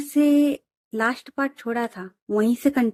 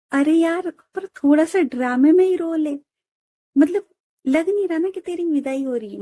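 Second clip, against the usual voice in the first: higher-pitched playful coaxing; coaxing friend; 6 semitones higher than usual. Acted. Friend coaxes the bride to cry just for show, playful. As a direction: wheedle her into a few fake tears.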